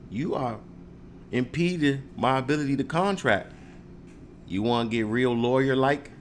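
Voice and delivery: ordinary talk, nothing marked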